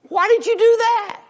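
high, loud indignant protest; indignant Chinese man. Acted. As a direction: squeal it out, shocked at being hit. Joke, slapped Chinese man protests.

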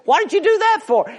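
high, loud, fast protest; outraged Chinese man. Acted. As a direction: yelp it out, hit again. Joke, slapped again, protests.

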